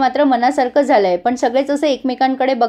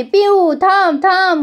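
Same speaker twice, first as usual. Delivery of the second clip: retold scream; 7 semitones higher, much slower than usual. Chhabi screaming for Pihu to stop, raised pitch. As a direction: mimic Chhabi shrieking after her friend. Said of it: "ही छबी जोरजोरात ओरडत असते".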